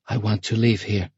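lightly voiced girl, chin out; a small, determined declaration; defiant girl. Sasha to the armed ferryman, mildly defiant. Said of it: "Sasha declared, thrusting out her chin".

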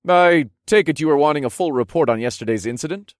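curious. Acted. Hero guessing what the king wants, curious voice. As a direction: lift the pitch as you venture a guess. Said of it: "I guessed in a curious voice".

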